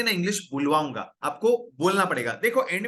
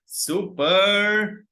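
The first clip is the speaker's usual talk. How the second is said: drawn-out praise; 2 semitones higher, 4 dB louder, much slower than usual. Real, not acted. Teacher praising class.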